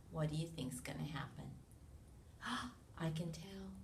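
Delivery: hushed suspense whisper; secretive storytime whisper. Real, not acted. Suspense aside to children.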